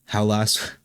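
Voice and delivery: holding back real tears, halting with long pauses; choked up, stopping and restarting; trying not to cry. Real, not acted. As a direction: fight to keep composure while speaking of a loss. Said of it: "I was trying not to cry".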